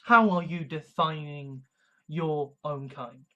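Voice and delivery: usual speaking voice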